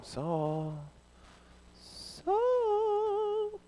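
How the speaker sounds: quiet, airy, floating Saul Saul; mock gentle ghostly call; soft sing-song Saul without force; soft drawn-out call. Shown as a demonstration. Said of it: "So it's not Christ going".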